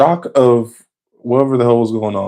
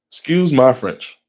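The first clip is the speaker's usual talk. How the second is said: usual speaking voice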